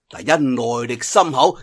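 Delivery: usual speaking voice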